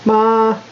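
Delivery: ma held high and level, first tone; first tone ma, high and steady. Shown as a demonstration. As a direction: sing ma on one high flat pitch. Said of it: "first tone would be mother".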